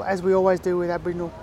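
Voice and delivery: usual speaking voice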